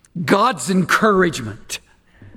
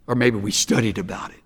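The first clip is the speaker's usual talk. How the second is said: ordinary delivery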